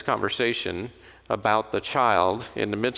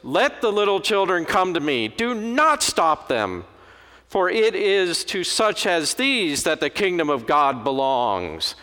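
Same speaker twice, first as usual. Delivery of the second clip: indignant Jesus; 5 semitones higher, 3 dB louder than usual. Shown as a demonstration. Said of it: "I suspect more like this".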